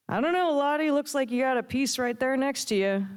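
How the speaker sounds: high-pitched teasing heckle; heckling sidekick. A narrator's reading. Barb teases Lottie about Franny, high heckling voice. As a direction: pipe up high to needle the boss.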